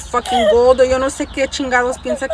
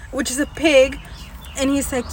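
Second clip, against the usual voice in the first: ordinary delivery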